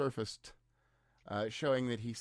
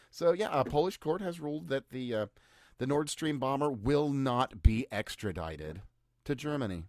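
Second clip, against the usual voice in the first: ordinary delivery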